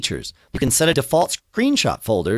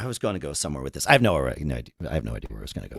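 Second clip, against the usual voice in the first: trailing off, low and weak; barely able to speak; 12 semitones lower, 7 dB quieter, much faster than usual. Real, not acted. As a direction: voice dropping very low and soft, fast. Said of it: "I can barely speak".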